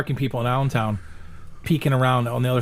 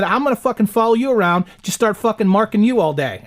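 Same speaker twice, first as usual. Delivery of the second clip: mock threat; 6 semitones higher than usual. Threatening the chalk guy, retold.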